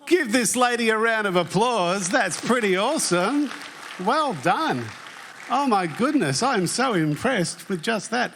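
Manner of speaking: rally the crowd to cheer a winner; real, upbeat congratulations; excited applause call. Real, not acted. Host calls for applause for winner.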